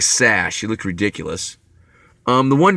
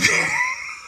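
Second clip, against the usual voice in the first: sudden high-pitched squealing laugh; 6 dB quieter than usual. Real, not acted. Laughing at own pigtails remark.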